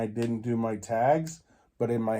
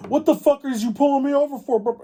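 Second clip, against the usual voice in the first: much higher, louder voice of an indignant dad; dad's angry outburst; 13 semitones higher, 5 dB louder than usual. Retold or imitated. Dad confronting the officer, indignant high loud yell. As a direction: let the dad blow up at the cop. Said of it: "would have started with my dad going".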